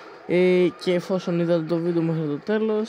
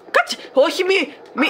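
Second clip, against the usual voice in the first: much higher frantic pleading; high panicked no, no; 12 semitones higher, 3 dB louder than usual. Real, not acted. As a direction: beg it to stop, panicking. Panics at a game moment.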